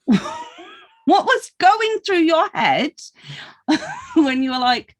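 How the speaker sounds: high, slow words broken by laughter; asking through real laughter; laughing question. Real, not acted. Host laughing as she asks her guest about bootcamp.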